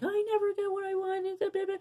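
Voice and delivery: put-on high, whining wounded-child voice; whiny victim voice. Mocking how we talk in victim mode, high whine. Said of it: "and we use that tone".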